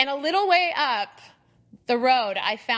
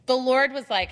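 normal talking voice

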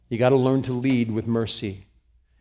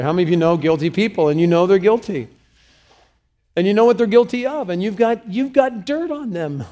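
higher, louder, fast and rising; preaching at full force, much higher and louder; fired-up preaching; 8 semitones higher, 9 dB louder, faster than usual. Real, not acted. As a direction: whip up the crowd. Preacher rousing the congregation.

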